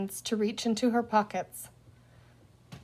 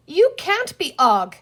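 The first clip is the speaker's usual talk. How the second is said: higher, louder, slow cross protest; 7 semitones higher, 7 dB louder than usual. Acted. Penny crossly correcting the little green man, slow. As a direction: insist crossly despite the fear. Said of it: "said Penny crossly".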